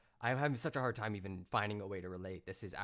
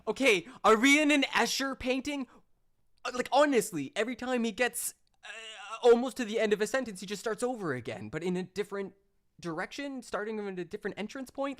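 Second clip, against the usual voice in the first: incredulous rant; 12 semitones higher, 8 dB louder than usual. Real, not acted. Streamer baffled by rambling clip.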